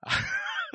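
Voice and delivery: short amused laugh burst; burst of high laughter. Real, not acted. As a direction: laugh out at the joke. Laughing at Asterix names.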